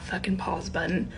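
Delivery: hushed, confiding whisper about losing patience; real soft whisper to other parents. Real, not acted. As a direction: whisper it like a confession. Confessing emotional struggle.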